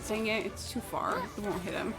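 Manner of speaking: usual speaking voice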